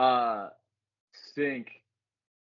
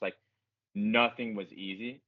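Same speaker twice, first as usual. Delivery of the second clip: ordinary talk, nothing marked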